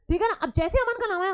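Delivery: normal talking voice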